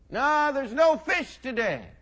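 high, loud, drawn-out holler; calling back. Acted. Fishermen answer the stranger on shore, hollering back. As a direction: shout the answer slowly over the water.

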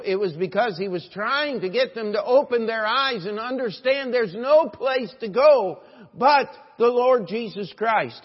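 loud, high, driving sermon delivery; full-force preaching. Real, not acted. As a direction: build to a shouted climax.

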